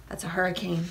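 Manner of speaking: ordinary delivery, nothing marked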